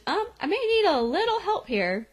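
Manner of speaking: high, small voice asking for help; mock-meek high plea; sheepish plea. Voicing girl stuck in the dark.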